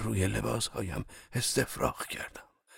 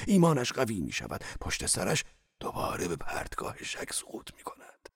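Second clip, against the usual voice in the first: lively tone; 5 semitones higher, 3 dB louder than usual. Acted. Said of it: "با لحنی جاندار ادامه داد".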